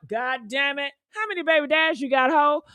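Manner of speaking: ordinary talk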